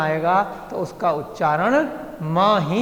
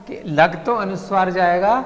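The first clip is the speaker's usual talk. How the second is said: ordinary talk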